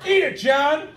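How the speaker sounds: crowing to a neighbour, half shouting; father bragging to his friend John at a barbecue; proud dad at a barbecue. Retold or imitated. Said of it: "He's like".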